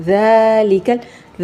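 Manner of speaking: slow "ذالک" with a gentle th-like ذ; drawn-out recitation, soft ذ in "ذالک"; soft dhaal. Shown as a demonstration. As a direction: ease into the ذ of "ذالک" and lengthen its aa. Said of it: "ذال کو ہمیشہ نرمی سے پڑھنا ہے".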